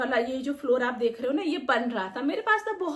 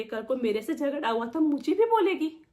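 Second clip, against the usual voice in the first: normal talking voice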